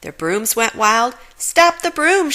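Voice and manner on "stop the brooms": high, loud urgent shout. Acted. Manager shouting as the brooms go wild, high and loud. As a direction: yell to stop the runaway brooms. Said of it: "shouted the manager".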